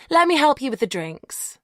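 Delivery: bright, confident offer; declaring brightly. A narrator's reading. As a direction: announce it cheerfully. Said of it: "she declares".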